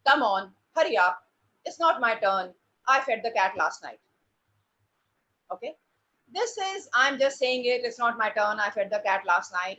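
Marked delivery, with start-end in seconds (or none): 0.1-4.0 low, unvarying monotone delivery. Shown as a demonstration. Drama teacher shows parents a lifeless dialogue, monotone. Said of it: "such a monotone". 6.9-9.8 monotone. Shown as a demonstration. Drama teacher repeats the line to show monotone. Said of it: "such a monotone".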